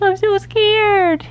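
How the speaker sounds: high, drawn-out little dog whimper; Toby, mock scared. Owner voices shaking Toby for viewers, mock scared. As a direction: stretch it out high, playing frightened. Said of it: "See Toby shaking?".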